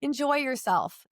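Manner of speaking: high, airy "all fine" brush-off; breezy mock-cheerful. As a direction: chirp it up to wave them off. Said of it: "I'm like".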